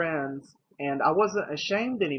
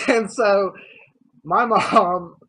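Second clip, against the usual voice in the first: real laugh breaking through the words; louder and higher, talking through laughter; 5 semitones higher, 9 dB louder, much slower than usual. Real, not acted. Telling how mom reacted to coming out, laughing.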